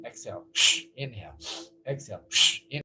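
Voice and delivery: quick, quiet, low breath-pumped cues; breath of fire. Shown as a demonstration. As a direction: pump each word out with the breath. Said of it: "This is called breath of fire".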